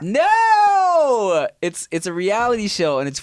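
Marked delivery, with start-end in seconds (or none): 0.0-1.5 loud, high exasperated shout of no. Real, not acted. Shouting at his friend's wrong guess, exasperated. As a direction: yell it, fed up with the wrong guesses.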